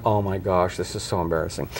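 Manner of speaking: low, soft mock groan of embarrassment; lightly voiced mortified daughter; embarrassed teen. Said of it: "Lydia's going".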